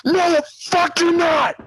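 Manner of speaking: high, outraged snap; mock freak-out at a friend; refusing to let him take the car, high and outraged; flipped out. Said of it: "I flipped the fuck out on him".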